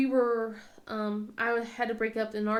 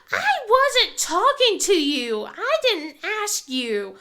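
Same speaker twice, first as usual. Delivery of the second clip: put-on higher, louder, slow indignant kid voice; mimicking sulky son; 8 semitones higher, 8 dB louder, slower than usual. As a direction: snap back like an offended boy. Imitating son's rude retort.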